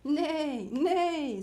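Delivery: loud, high spoken neigh; horse neigh. A narrator's reading. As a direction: call out like a storybook horse. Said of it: "said the horse".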